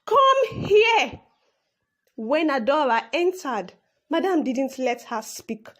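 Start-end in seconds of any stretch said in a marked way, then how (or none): 0.0-1.2 high, loud summons; screaming. A narrator's reading. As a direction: scream for the maid. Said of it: "Then she screamed".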